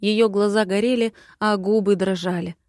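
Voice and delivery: plain reading voice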